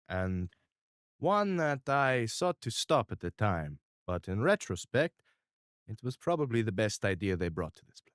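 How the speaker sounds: lightly voiced calm mob boss; Mister R, measured. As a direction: speak like a calm, controlling patriarch. GM voicing Mister R.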